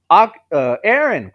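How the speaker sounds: loud, high cry of his name. Acted. Rias crying out as Aaron is hit, loud and high. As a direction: cry out in alarm. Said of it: "cried Rias".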